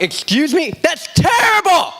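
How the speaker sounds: high, loud, slowed outraged umpire; mock indignant umpire. Umpire firing back at the coach, loud and high. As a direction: throw it back at the coach in disbelief.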